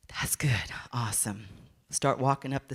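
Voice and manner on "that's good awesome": breathy whisper of relief; relieved whisper. Retold or imitated. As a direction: exhale it, glad he's gone. Relieved he's not downstairs.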